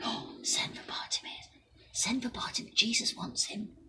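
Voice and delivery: softly passed-on whisper. A narrator's reading. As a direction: whisper the message down the crowd. Message passed back through crowd.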